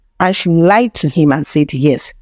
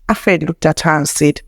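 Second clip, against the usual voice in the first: ordinary delivery, nothing marked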